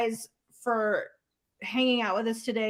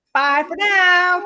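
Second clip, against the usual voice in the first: slow, higher, louder sign-off; drawn-out goodbye; 7 semitones higher, 7 dB louder, much slower than usual. Real, not acted. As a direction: stretch out a cheerful bye. Live stream sign-off.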